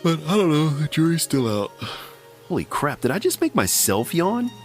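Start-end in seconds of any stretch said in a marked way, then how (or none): none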